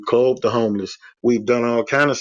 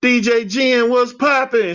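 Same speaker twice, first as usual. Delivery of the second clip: high, bright hyped-up hello; bouncy greeting; 11 semitones higher than usual. Retold or imitated. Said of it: "my normal self".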